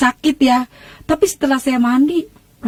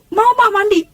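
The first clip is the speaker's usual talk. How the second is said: high-pitched retold reply; calling back; 9 semitones higher than usual. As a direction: answer mom in a high acted call. Acts her reply calling to mother. (menyahut emak, nada tinggi)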